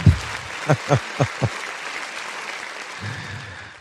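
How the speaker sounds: long, breathy laugh; real laughter. Real, not acted. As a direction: laugh it out at length, mostly breath. Amused by viewer's sarcasm comment.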